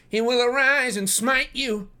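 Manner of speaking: very high, loud prophecy of doom; cried-out curse. A narrator's reading. Departing suitor threatening divine punishment, crying out. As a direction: cry out divine vengeance. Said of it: "cried young Drebber".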